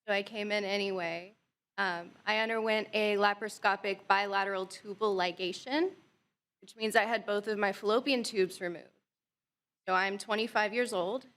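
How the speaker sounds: ordinary talk, nothing marked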